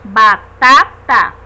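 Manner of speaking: batata lento e forte, destacando o segundo TA; tônica em baTAta. Shown as a demonstration. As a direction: separe as sílabas de batata e bata no TA do meio. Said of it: "forte da palavra batata é a segunda". (baTAta, bem silabado e forte)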